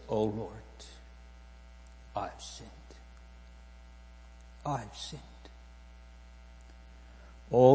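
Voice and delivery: hushed, halting acted confession with long pauses; penitent confession. Preacher voices Hagar confessing to the Lord, hushed and penitent. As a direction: confess softly, phrase by phrase.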